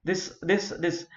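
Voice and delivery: ordinary delivery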